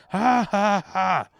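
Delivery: loud mock ha ha echoing the kid; mocking back loudly. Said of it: "I went".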